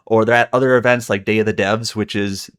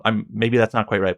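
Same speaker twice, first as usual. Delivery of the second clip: ordinary talk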